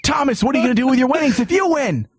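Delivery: usual speaking voice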